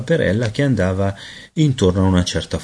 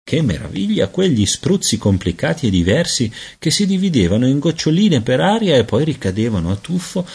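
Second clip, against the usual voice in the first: plain reading voice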